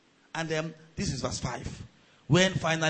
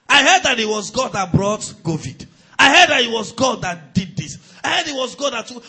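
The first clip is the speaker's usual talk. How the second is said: voicing rumors; 7 semitones higher, 11 dB louder than usual.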